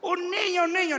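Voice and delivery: high, loud mock woman's voice calling a man's name. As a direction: call out urgently as the woman in the tree scene. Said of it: "Y llega, le dice".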